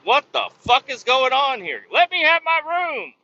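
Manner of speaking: high, loud mock outrage; retold indignant yell at the front desk; exasperated outburst.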